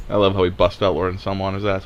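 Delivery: normal talking voice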